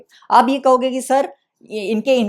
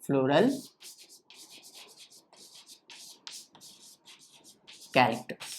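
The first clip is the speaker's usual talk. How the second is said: low, soft dictation with a long gap; 7 semitones lower, 11 dB quieter than usual. Shown as a demonstration. Teacher gives students the heading to write, dictating. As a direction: dictate word by word, low and quiet.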